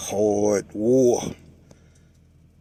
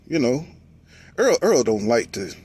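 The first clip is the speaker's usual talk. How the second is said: usual speaking voice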